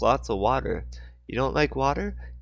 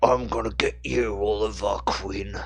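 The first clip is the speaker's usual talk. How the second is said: Deathstroke voice. Shown as a demonstration. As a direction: drag the name out like a villain. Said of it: "Something like that, like".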